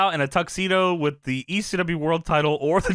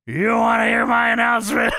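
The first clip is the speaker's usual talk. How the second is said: louder, higher teasing challenge; taunting Funk; 5 semitones higher, 5 dB louder than usual. Retold or imitated. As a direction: taunt him with the question. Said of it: "Funk looks at him and says".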